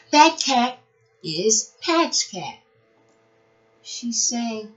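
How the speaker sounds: bright, lively storybook reading; animated read-aloud for preschoolers; reading to children. Acted. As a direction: read it like a picture book to little kids. Reads picture book to children.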